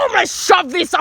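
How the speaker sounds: high, hammed-up customer rage; imitated aggressive outburst; mock angry threat.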